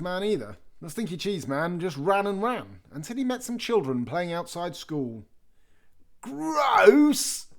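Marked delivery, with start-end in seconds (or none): 6.2-7.5 much higher, loud cry of disgust; disgusted little girl. A narrator's reading. A little girl squeals at the smell, high and loud. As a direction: squeal at the stink. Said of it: "said a little girl".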